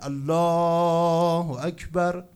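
loud, high drawn-out cry; imitated warning shout; retold shouting. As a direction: call it out long to be heard afar.

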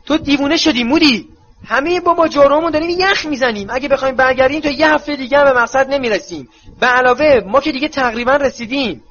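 put-on young witch shouting that they're freezing; Tonks shouting. A narrator's reading. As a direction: yell back in frozen protest. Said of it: "تانکس از جلو فریاد زد".

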